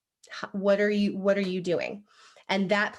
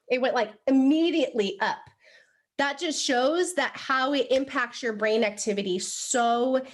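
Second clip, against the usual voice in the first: ordinary delivery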